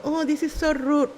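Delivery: high prissy pearl-clutching tone; a prim Facebook scold; mock offended. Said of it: "some people go".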